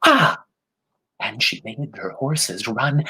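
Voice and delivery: ordinary delivery, nothing marked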